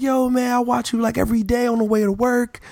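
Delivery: high, eager hyped-up voice; excited fan voice. Retold or imitated. The Sports Nation guy telling the speaker he watches him daily, in an eager high voice.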